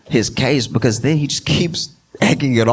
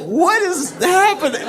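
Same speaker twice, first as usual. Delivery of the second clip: laughing disbelief. Retold or imitated. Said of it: "even [name] is like".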